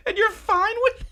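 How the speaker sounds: squeaky high-pitched disbelief; incredulous mock. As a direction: squeal the question in mock shock. Mocking mom's reaction.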